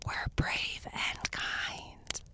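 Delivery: slow, hushed whisper; very soft, drawn-out whisper. Shown as a demonstration. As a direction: whisper it slowly, like a shared campfire secret. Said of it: "whisper with me".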